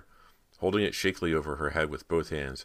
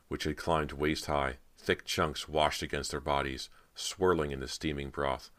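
usual speaking voice

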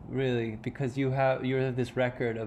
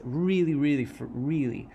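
usual speaking voice

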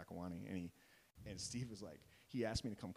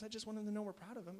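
high, louder imitation of a defensive wife; retold pleading justification; defensive protest; 13 semitones higher, 5 dB louder than usual. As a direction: plead your case in mock protest. Acts out wife defending herself.

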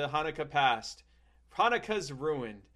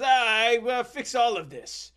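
high, grand French rescuer voice; Jacques voice; 8 semitones higher, 6 dB louder than usual. Retold or imitated. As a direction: promise it like a gallant Frenchman.

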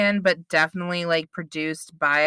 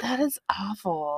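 whispering; 8 dB quieter than usual. Real, not acted. Hushed reply about unsent package.

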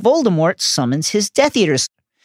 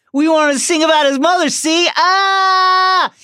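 fast, much higher, mocking, rising into a yelled "Ah!"; taunting shout; 10 semitones higher, 3 dB louder than usual. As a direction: needle him, then yell. Draco taunting, building to shout.

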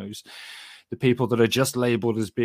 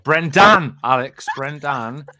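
"Brendan" shouted with the last syllable punched; high, loud "Brendan" with a heavy final syllable; emphatic correction; 4 semitones higher, 10 dB louder, much slower than usual. Acted. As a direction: hammer "Brendan" loudly, stretching the ending. Co-host mockingly corrects Alex on the name.

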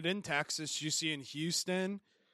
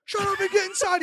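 much higher, louder frantic shouting; mock yell; 8 dB louder than usual. Neighbor yelling at the tiger owner, frantic shout. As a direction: bark the order in a panic. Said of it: "He's like".

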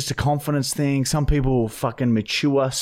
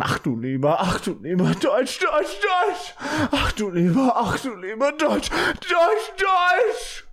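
high-pitched comic German shouting, climbing higher; mock German yelling; 12 semitones higher than usual. Host announcing the German phrase segment, mock German yelling. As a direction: build to a squealed German chant. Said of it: "I yell in German".

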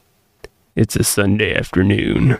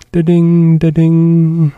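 loud sung door chime; door chime imitation; 5 semitones higher, 7 dB louder, slower than usual. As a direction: ring it like a shop bell.

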